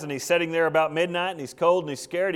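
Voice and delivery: ordinary talk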